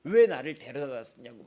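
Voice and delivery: put-on loud, wailing cry of a protesting child; crying child's yell. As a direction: howl it out like a child sobbing in protest. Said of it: "막 우는 거죠". (우는 아이처럼 크게 외치며)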